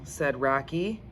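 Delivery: plain reading voice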